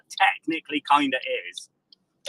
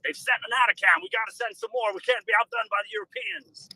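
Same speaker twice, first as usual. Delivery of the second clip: much higher, squeaky mock American. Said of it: "the Americans will be like".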